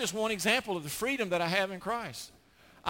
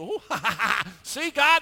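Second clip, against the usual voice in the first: jeering cackle as the accuser; mocking laugh; 3 semitones higher, 8 dB louder than usual. Satan laughing at David before God, mocking cackle. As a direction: laugh in gloating scorn.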